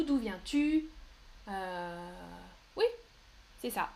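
usual speaking voice

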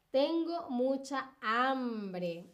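each word set apart slowly; slow dictation. Shown as a demonstration. Teacher dictating a quiz sentence slowly to students. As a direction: read it out slowly for the class to spell. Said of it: "la siguiente pregunta y es".

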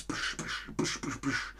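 soft unvoiced psch sounds; imitated punching noise. Teacher retelling how Melitta made the filter, soft psch noises. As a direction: whisper psch psch like nails going in. Mimics the blotting paper being punched.

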